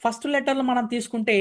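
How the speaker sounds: ordinary talk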